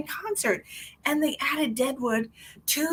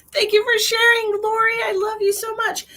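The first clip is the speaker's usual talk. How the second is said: high, loud gushing thanks; excited shout; 12 semitones higher, 7 dB louder than usual. Real, not acted. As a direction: shout your thanks to a friend in chat. Thanking viewer on live stream.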